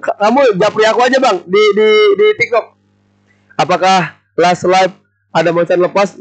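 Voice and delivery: ordinary talk, nothing marked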